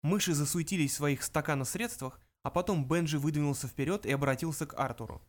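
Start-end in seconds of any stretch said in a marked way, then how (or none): none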